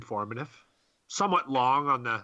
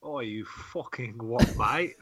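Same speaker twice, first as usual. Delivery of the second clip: put-on slow, cocky British geezer accent; mock British accent; slower than usual. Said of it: "I'll give you some British accent".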